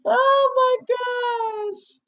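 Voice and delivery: high, slow drawn-out exclamation; giddy disbelief; exclaiming. Real, not acted. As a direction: stretch it out in delight. Laughing at herself.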